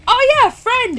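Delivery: an eager puppy greeting a new friend; excited dog voice. Retold or imitated. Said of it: "your service dog is like".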